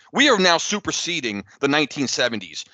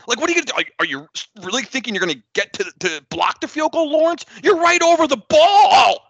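incredulous rant building to a high shout; exasperated scolding; 6 semitones higher, 5 dB louder, faster than usual. Acted. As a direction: scold the player in disbelief, ending loud and high. Mock scolding an absent player.

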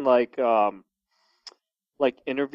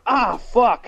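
pained yelp; 8 semitones higher, 4 dB louder than usual. Retold or imitated. Mock reaction to bee sting.

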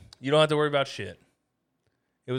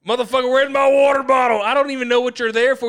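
much louder and higher, furious shouting at the kidnappers; angry hungover yelling; 6 semitones higher, 5 dB louder than usual. Pretend. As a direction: roar like you just woke up mad and thirsty. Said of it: "I'm waking up angry".

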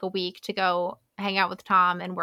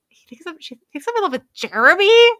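shocked disbelief; 8 semitones higher, 7 dB louder than usual. Retold or imitated.

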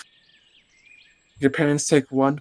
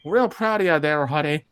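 put-on higher-pitched, folksy Minnesota accent; proud Minnesotan father, pitched up; Minnesota dad accent; 4 semitones higher than usual. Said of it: "you don't need to carry the accent".

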